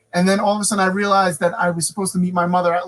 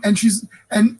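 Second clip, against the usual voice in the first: ordinary talk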